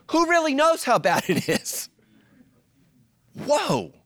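high, loud, laughing disbelief; incredulous laugh. Real, not acted. Pastor reacting to Jeremiah's verse before the church, amazed and laughing. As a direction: gasp it out like the verse floored you.

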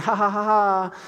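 high, fake ha-ha laugh; sarcastic laugh. Pastor retelling his joking text to a friend, sarcastic laughing. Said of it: "I said something real sarcastic".

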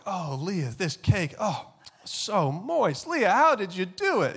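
high, gushing mock-praise of the cake; high, slow swooning over the cake; gushing mom. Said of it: "my mom acted like she was Martha Stewart".

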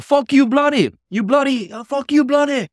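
put-on high, loud Indian-accented insult. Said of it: "I can't do my Indian accent right now".

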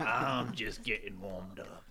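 a slow, low mocking parrot; deep mock echo. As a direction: drop into a low growl to echo him. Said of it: "repeating in deep voice".

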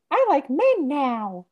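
high, drawn-out cartoon kid voice; slow, loud, high-pitched mimicry; Ralph Wiggum. Host quoting the skateboard scene, in a high Ralph voice. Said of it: "the other Ralph Wiggum scene".

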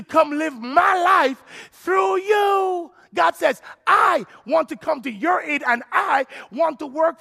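high, loud, shouted sermon climax; preaching at full force. Acted. Preacher shouting God's promise to the congregation, fervent. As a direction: preach it out, lifting the roof.

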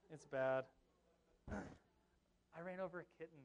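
usual speaking voice

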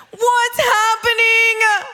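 high, drawn-out mock wail; mock dismay. Mother baffled by her lying children, mock wailing dismay. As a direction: stretch it into a dramatic high lament.

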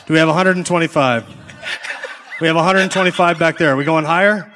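loud, rising auctioneer bidding calls. Acted. Runs a charity auction.

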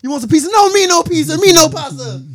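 very high, loud cartoon Italian; hammed-up Italian protest at full volume; mock Italian accent. Italian immigrant refusing pizza and pasta, exaggerated Italian accent. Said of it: "look at that Italian American over there".

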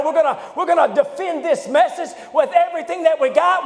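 rising, shouted preacher's build to the fight; fiery preaching. Acted. Preacher rousing the church to fight, shouting.

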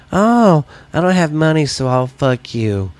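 lightly acted come-on from a porn scene; mock porn customer. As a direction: play a corny porn line. Porn scenario character voiced.

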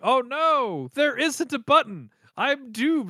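high, hammed-up wail; mock despair. Joseph finding no button to save him, mock despair. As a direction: cry out in cartoon hopelessness.

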